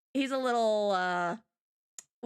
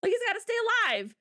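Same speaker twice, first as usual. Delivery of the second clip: higher, rushed mock protest; 10 semitones higher than usual. Kagome refuses to let him die, higher mock protest. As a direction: blurt it out as Kagome. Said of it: "Kagome's like".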